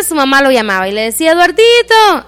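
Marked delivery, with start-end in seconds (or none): 1.3-2.3 high, drawn-out call across the yard; a mother calling her son to come in. Acted. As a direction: call the boy in from play.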